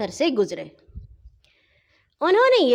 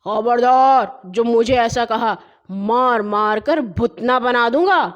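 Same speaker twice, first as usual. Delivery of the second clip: loud roaring threat; furious cobbler thundering a threat; 4 dB louder than usual. Acted. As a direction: roar at him to stop. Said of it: "گرج کر بولا".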